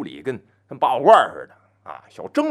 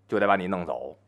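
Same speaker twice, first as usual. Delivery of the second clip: ordinary delivery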